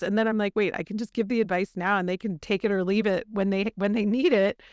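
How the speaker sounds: normal talking voice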